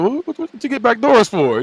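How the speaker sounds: mocking question.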